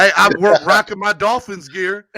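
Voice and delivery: loud laughing boast; laughing brag. Real, not acted. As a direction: laugh it out proudly. Laughing brag about gear.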